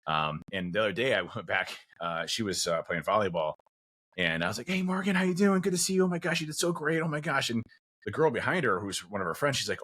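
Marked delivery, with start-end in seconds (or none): 4.7-7.5 high, bubbly excited dad voice; excited high greeting. Retold or imitated. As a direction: gush over her like a proud dad. Enthusiastic dad greeting daughter.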